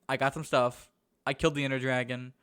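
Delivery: usual speaking voice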